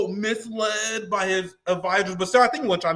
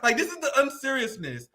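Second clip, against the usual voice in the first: ordinary talk, nothing marked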